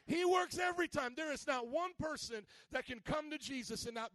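high, driving preacher delivery; impassioned pulpit proclamation; full-force preaching. Real, not acted. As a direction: build to a shouted peak and keep proclaiming.

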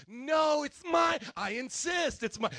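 high, loud playful squabble; mock insistence. Spouse outdoing the other in taking blame, hammed-up insistence. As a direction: fight comically to take the blame.

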